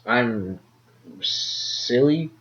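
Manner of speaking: normal talking voice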